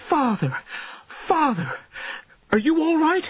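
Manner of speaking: very high, worried daughter's cry; distressed high-pitched calling; anxious cry. A narrator's reading. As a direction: cry out in alarm for your father. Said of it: "she cried".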